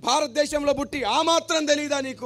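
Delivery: retold rebuke. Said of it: "అని గద్దించాడు".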